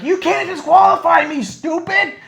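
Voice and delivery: high, loud mock-outraged yell; imitated angry yelling. Jericho yells at the ref to stop counting, imitated angry yell. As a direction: mimic a wrestler shouting down the ref. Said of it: "he keeps yelling at him".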